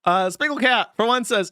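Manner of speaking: usual speaking voice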